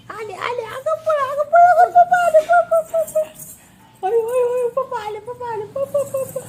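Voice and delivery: very high, loud, gleeful run-run call; playful chase cry. Pretend. Mother plays chase with the baby, high, loud and excited. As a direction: hype the baby up to run from papa.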